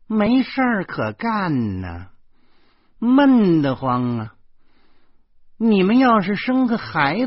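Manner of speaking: high, loud, slow put-on sarcasm; affected, mannered tone. A narrator's reading. Aunt needles the couple about having a baby, affected and sarcastic. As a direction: stretch every word with mock weariness. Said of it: "拿腔作调地说".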